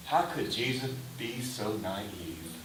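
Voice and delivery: normal talking voice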